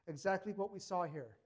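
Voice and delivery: normal talking voice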